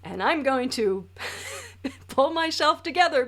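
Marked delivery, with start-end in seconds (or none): none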